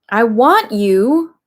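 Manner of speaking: lightly voiced bossy crocodile; declaring crocodile. A narrator's reading. Crocodile ordering the dentist, slow and bossy. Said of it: "Crocodile declared".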